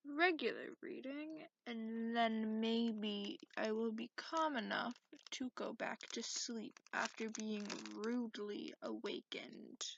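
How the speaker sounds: ordinary talk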